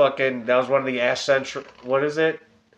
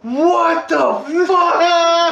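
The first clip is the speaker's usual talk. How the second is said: much higher, slow drawn-out shout; mock crowd shout; 5 dB louder, much slower than usual. The wrestling crowd yelling at the Cena fan, slow mock shout. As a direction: stretch it out like a stunned crowd.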